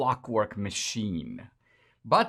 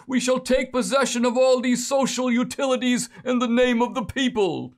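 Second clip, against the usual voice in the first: put-on much higher Brooklyn accent, ranting; Bernie Sanders accent; 11 semitones higher, 3 dB louder than usual. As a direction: orate in a Brooklyn old socialist's accent. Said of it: "I'll do in my Bernie Sanders accent".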